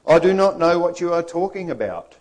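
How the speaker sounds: high, forceful protest; loud denial. Acted. Peter answers the charge of being a disciple, loudly denying it. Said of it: "Peter the not so confident".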